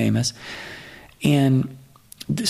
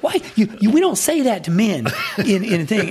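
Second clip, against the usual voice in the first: higher, laughing mock scolding; mock scolding Daryl; 8 semitones higher than usual. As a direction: tease him like he broke a rule, half laughing. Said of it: "He was literally saying this to Jackie".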